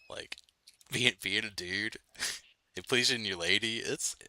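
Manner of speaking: ordinary talk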